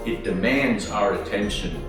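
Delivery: usual speaking voice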